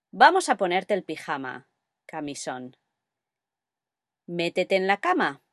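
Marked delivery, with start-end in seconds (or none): 2.0-2.8 soft aside. Real, not acted. Alternative word added softly.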